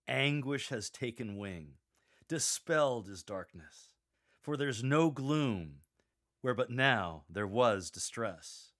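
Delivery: slow, weighty reading with "Anguish" and "Dispelled" leaned on; slow emphatic reading. Shown as a demonstration. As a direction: read it slowly, stressing "Anguish" and "Dispelled". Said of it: "Emphasize anguish and dispelled".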